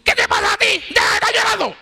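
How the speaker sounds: high, loud, hammed-up scolding; mock scolding. Preacher mocking a scolding Jesus never gave to the mourning widow, loud high scold. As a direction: snap at her like a nagging scolder. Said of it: "Él no dijo eso".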